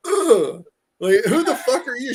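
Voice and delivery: high, loud, disgusted and laughing; imitating a girlfriend recoiling from a stranger; mock disgusted girlfriend. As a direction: play the repulsed girlfriend who doesn't recognize him. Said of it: "And she's like".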